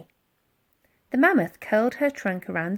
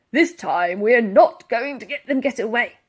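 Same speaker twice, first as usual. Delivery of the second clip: determined Barker; 5 dB louder than usual. A narrator's reading. Barker vows capture.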